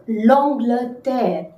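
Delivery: L'Angleterre slowly, nasal long-gle-tair; slow careful model word; slow French model. Shown as a demonstration. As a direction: stretch out L'Angleterre. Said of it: "How to say England?".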